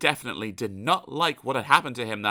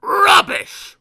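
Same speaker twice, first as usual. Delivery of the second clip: high, loud scornful retort; 12 dB louder than usual. Acted. Mister Grumpy retorting to Mister Happy, high and loud. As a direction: spit it back. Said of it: "retorted Mister Grumpy".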